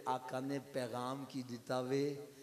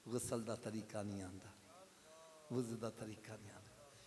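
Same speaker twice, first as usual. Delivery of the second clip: ordinary talk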